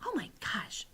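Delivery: soft, whispery dismay; muttered. A narrator's reading. Sophie realises her mistake, muttering softly. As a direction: mutter it under your breath. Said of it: "Sophie muttered".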